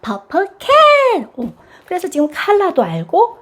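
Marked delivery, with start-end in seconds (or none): 0.0-1.3 high, very slow together-phrase; slow joint repeat. Shown as a demonstration. Mom inviting the child to repeat, high and slow. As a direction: say it together slowly. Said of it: "다 말해보자".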